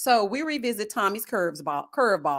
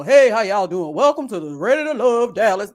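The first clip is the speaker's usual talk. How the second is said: Tommy's welcome; 6 dB louder than usual. Retold or imitated. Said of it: "Tommy welcomes us as usual".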